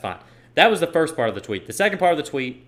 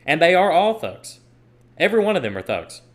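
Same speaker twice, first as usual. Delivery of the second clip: emphatic outburst; 5 semitones higher, 5 dB louder than usual. Real, not acted. Angry rant about looters.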